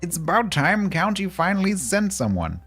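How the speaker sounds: high posh RAF pilot voice; wartime posh. Acted. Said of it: "generic posh R A F pilot voice".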